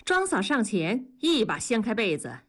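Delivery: ordinary delivery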